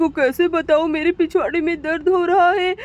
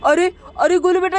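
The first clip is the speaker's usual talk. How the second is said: ordinary talk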